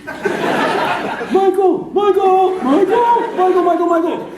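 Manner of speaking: very high, loud creature cry; squealed, repeated cartoon creature call; Pokémon voice. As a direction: shriek the name like a manic Pokémon. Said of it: "they only say their own name".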